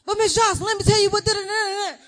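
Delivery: mock child babbling over the teacher; imitating a chattering kid. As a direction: squeal like an eager child cutting in. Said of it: "kids interrupting".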